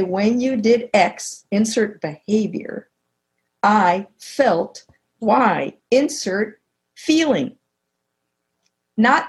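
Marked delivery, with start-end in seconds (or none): 0.0-7.6 slow, with a pause after each part; slow teaching template. Shown as a demonstration. Coach giving a listener the feedback formula, slow and deliberate. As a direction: lay out the formula piece by piece, slowly. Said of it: "one way to stay on your side of the net is to say".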